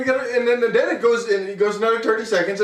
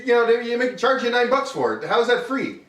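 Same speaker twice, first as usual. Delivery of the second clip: ordinary delivery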